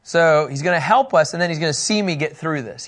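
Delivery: ordinary delivery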